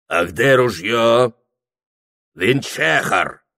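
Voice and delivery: slow, stretched Circassian voice; Circassian character. A narrator's reading. Circassian asking Прохор for the rifle, drawn-out accent. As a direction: draw out the question as a Circassian. Circassian asks about the gun.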